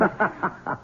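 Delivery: high, soft, unvoiced laugh; breathy relieved laugh. Husband relieved that there is no baby, breathy laugh. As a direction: let out a relieved huff of a laugh.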